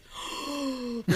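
big acted gasp of realisation; awed kid's gasp. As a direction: gasp like a kid let in on a secret. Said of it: "And the kid's like".

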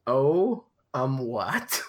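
blank, bewildered "Oh, um, what?"; dazed confusion. Shown as a demonstration. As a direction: sound completely lost. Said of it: "Like, like that".

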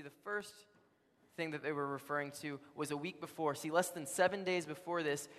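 ordinary delivery

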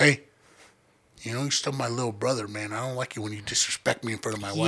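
low, soft warning; hushed big-brother reprimand; private scolding. Retold or imitated. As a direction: pull him close and warn him quietly. Brother privately scolds, grabbing neck.

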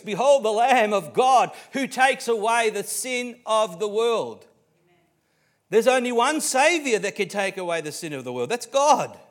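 full-force preaching. Real, not acted. Preacher declaring the one Saviour to the congregation, shouted and high. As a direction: proclaim it to the back rows, drawing words out.

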